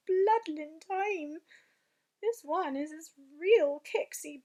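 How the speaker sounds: agitated. Acted. BFG agitated about nightmare.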